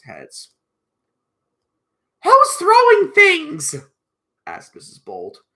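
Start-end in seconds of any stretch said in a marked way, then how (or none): 2.2-3.7 high, slow, very loud protest; indignant cry. Acted. Missus Bold as earth falls on heads, high indignant cry. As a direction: call out slowly in a high scolding voice.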